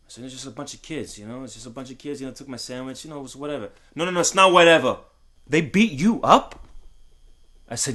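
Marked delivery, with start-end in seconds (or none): 0.2-3.7 much softer, brushing it off; quiet boy. Retold or imitated. Boy downplays the beating. 4.0-6.7 angry father. Retold or imitated. Father rejects son's excuse.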